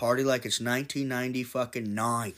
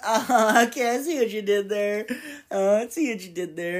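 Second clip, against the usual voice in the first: high, louder voice catching on to the joke; mock slow realization; 9 semitones higher, 5 dB louder than usual. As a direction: play the guy who finally gets it. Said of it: "it takes people like".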